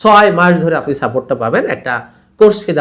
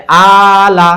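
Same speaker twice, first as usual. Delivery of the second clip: আলা with a long, loud held আ and short লা; long first vowel; 3 semitones higher, 7 dB louder, much slower than usual. Shown as a demonstration. Teacher demonstrating the first word, long আ in আলা. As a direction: stretch আ, then drop briefly onto লা. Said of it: "টান দিলাম, লাম জবর লা".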